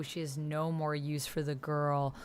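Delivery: ordinary delivery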